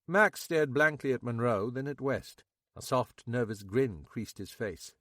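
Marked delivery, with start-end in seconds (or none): none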